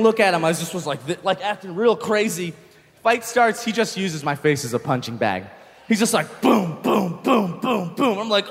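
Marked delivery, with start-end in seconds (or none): 6.4-8.2 punchy mouthed boom sound effects; a run of booms like landing punches; imitated punches.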